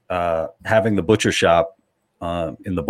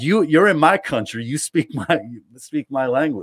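lightly voiced indignant local woman, pitched up; 5 semitones higher than usual. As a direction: imitate an angry lady laying down the law. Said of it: "this is a lady from the".

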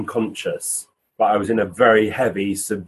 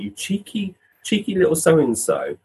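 mock indignation; 7 semitones higher than usual. Medium recalling a spirit child's cheek, mock indignant. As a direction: play it amused-offended.

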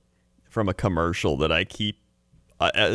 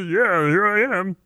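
Kermit; 11 semitones higher than usual. Retold or imitated. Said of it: "the Ray Romano's just the Kermit blend".

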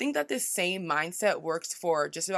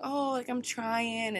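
slow, higher, shy brush-off; downplaying herself; 5 semitones higher, slower than usual. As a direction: shrink away from the claim. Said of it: "why am I sitting here saying".

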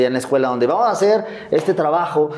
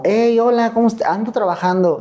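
high, breezy phone voice; mother calling her son, high and breezy; 9 semitones higher than usual. Retold or imitated. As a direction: brush it off lightly, all cheer. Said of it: "hablaba como".

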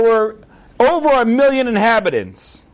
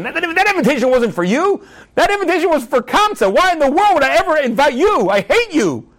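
acted-out outrage in a higher-pitched voice; angry yelling; 5 semitones higher than usual. Host yelling at his enemy at the party, angry. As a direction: play the host berating an unwanted guest. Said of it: "starts yelling at him".

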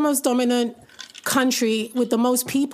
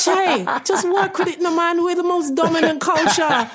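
urging a friend; 4 semitones higher than usual. Retold or imitated. Telling Che to accept Jamaica's dominance, raised and insistent. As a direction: press him to just go along with it. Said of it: "And I'm like".

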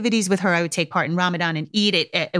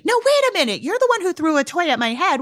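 high-pitched, protesting realization; mock indignant parent; 10 semitones higher than usual. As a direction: jump up in pitch as if catching the trick. Acting parent realizing they are apologizing.

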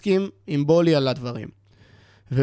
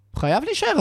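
high insistent repeat; 11 semitones higher than usual. Real, not acted. Fan insisting to co-hosts the player must stay, pitched up high. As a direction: pitch jumps much higher, insisting.

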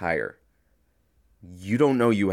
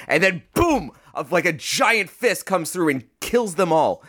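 higher, louder burst of sudden impact; dramatic retelling; 9 semitones higher, 4 dB louder than usual. Retold or imitated. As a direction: hit it like a shock twist in a story.

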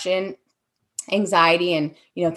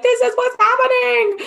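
much higher and louder, almost a shout; urgent outburst; 6 dB louder than usual. Retold or imitated. Wanting to shake the people she works with, urgent shout. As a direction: shake them awake with it. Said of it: "I wanna shake them and be like".

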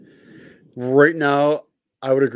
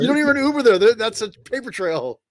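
high and loud, stress on even; louder and higher, punch "even"; excited; 10 semitones higher, 7 dB louder than usual. Acted. Topping the joke.

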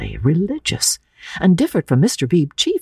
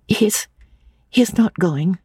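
hoarse; 7 semitones higher, slower than usual. A narrator's reading. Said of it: "she said hoarsely".

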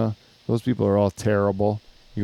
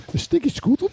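very high comic squeak; squeaky silly voice; faster than usual. Acted. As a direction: pitch way up, playful. Joining the silly voice bit.

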